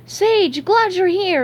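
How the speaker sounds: high, loud, eager cartoon voice; eager greeting. A narrator's reading. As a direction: greet him in a relieved rush. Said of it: "hurrying over to him".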